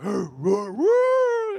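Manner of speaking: mocking roars, climbing very high; mock lion roar. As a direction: silly roar leaping up into a high howl. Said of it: "I started mocking".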